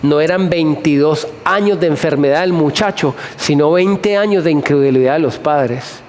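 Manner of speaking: usual speaking voice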